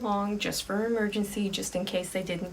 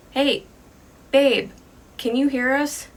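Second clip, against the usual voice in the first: calling loudly into the woods, higher; a loud call to find her boyfriend; shouting out; 6 semitones higher, 9 dB louder than usual. Retold or imitated. Lily calls out to her lost boyfriend, loud shout. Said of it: "Lily just kinda shouts out".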